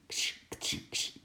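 soft voiceless scrabbling sound effect; whispered scratching noises. A narrator's reading. Strange scratching from inside the moving van, softly whispered. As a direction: imitate faint claws scratching, whispered. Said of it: "krabbelende geluiden".

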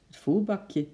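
usual speaking voice

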